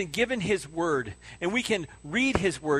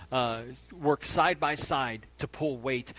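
normal talking voice

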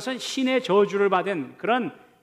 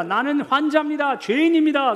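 raised, higher-pitched declaration; acted leper's cry; 5 semitones higher, 3 dB louder than usual. Leper to approaching people, crying out. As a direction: cry out as an outcast warning others away. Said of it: "끊임없이 소리 지릅니다".

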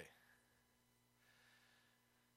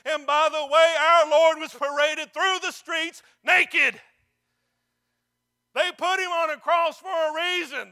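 loud, raised-pitch pulpit challenge; full-force preaching; 5 semitones higher, 10 dB louder than usual. Real, not acted. Preacher challenging the church about standing up for Jesus, shouted and impassioned. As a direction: drive each phrase out to the whole church.

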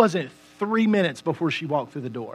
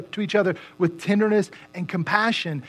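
ordinary talk, nothing marked

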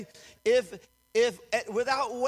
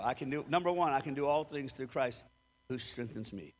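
quiet recited confession; 6 semitones lower, 3 dB quieter than usual. Acted. Pastor reciting his ten confessions, soft and measured. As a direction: recite it inward, almost to yourself.

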